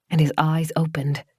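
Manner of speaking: ordinary delivery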